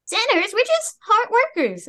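high-pitched innocent protest; protesting character. Acted. Stella answers Brother Faust's accusation, high indignant voice. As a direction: put on a high little character voice.

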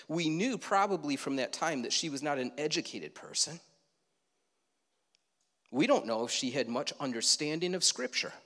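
ordinary talk

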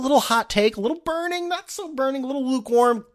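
a hot take with a twang; twangy sarcastic voice. Pretend. Host mocking his own Twitter persona, high twang. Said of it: "with a little twang and a little sarcasm".

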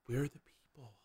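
hushed, eerie whisper. Pretend. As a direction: breathe it out softly like a lost ghost. Whispered, empty world.

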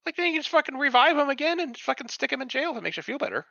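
very high, sarcastic sing-song; mock the idea by pitching way up; sarcastic mockery. Real, not acted. Speaker mocks the plan to revive the killer, sarcastic high.

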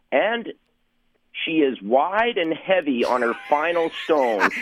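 deadpan play-by-play, slowing to land the gag; on-air call. Retold or imitated. Radio host calling a curling highlight on air, slow announcer call.

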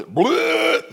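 high, loud mock vomiting sound; retching bleh. Pastor mimicking his son vomiting at dinner, loud retch. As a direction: imitate a kid throwing up.

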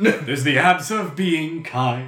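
slow, loud, grand proclamation; grand announcer voice. Pretend. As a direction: announce it like a hero's feature. Mock-heroic naming of muscles.

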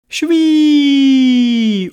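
high, loud, falling whoosh; long gleeful whee. Acted. All animals race off on the sled, high gleeful whee. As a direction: squeal a long slide downward.

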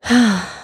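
a short weary sigh. Acted. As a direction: let out a tired sigh. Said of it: "Prince Abdullah sighed".

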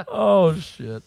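ordinary talk, nothing marked

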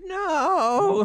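high, drawn-out wail of "no"; hammed-up cry of horror; mock dismay. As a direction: wail it in exaggerated despair. Acting out reaction to flood of emails.

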